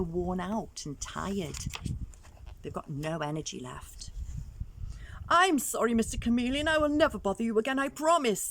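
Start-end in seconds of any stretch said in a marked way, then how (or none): 5.3-8.5 louder and higher, with a fast rushed middle promise; calling out sorry. Acted. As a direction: beg Chameleon to stop. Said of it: "they each called".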